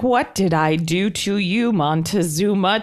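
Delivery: slow, stiff mock delivery; mock-imitating a flat joke read slowly; poorly delivered joke. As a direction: drag it out like a bad joke. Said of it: "so poorly said and done".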